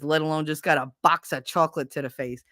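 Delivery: normal talking voice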